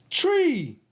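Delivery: tree said high and clear with the T R blend; modelled word. Shown as a demonstration. Speech host models the word for kids. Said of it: "Can you say tree?".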